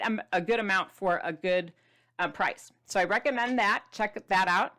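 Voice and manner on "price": usual speaking voice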